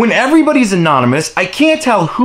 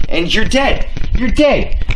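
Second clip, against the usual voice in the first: ordinary talk, nothing marked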